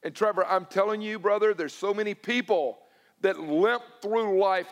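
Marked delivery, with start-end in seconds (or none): none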